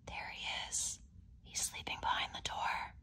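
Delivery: don't wake the sleeping bear; whisper. Acted. Pointing out the sleeping bear to the child, whispering. Corduroy asleep behind the door.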